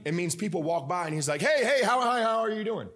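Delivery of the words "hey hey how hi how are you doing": eager high-pitched hailing; imitating an overfriendly porch greeter; calling out greetings. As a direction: greet strangers over-eagerly. Dad hailing passers-by from porch.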